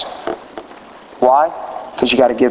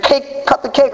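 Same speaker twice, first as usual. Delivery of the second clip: put-on high, insistent little girl voice, much higher; imitating daughter; 13 semitones higher, slower than usual. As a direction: pipe up like an impatient daughter. Daughter voiced at cake cutting.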